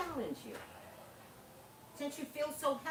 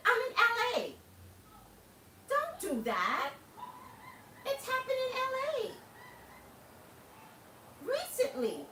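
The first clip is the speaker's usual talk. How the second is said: exasperated; 13 semitones higher, 4 dB louder than usual. Real, not acted. Exasperated rant at viewers.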